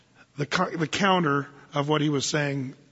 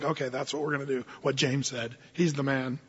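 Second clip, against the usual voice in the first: mock quiet aside; 4 semitones lower, 6 dB quieter than usual. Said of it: "I was like".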